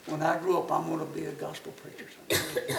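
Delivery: put-on low, soft boyish promise, fading; solemn oath. Boy swears to his granny to preach, low and subdued. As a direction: drop low and quiet, reluctantly sworn. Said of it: "looked my granny in the eye".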